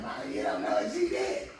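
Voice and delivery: loud, heated acted-out protest; retold protesting yell. Son arguing with his mother over a woman, protesting yell. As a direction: yell back at Mama in protest.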